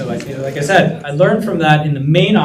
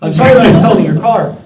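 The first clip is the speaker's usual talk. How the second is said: louder, fast, pleading rush; apology to an angry boss, hurried and louder; urgent apology; 4 semitones higher, 7 dB louder, faster than usual. Retold or imitated. Begging the angry boss, hurried and loud. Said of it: "This is ugly".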